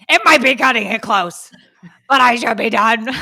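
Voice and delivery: loud, bright mock-cheerful voice; mock chirpy reply. Wife parodies husband's reply in a loud chirpy voice. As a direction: chirp it like a sunny sitcom husband. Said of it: "how it was intended".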